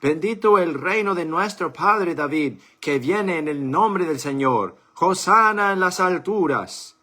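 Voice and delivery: loud high joyful acclaim; crowd acclaiming. A narrator's reading. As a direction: hail him like a cheering crowd. (aclamando a voz en cuello)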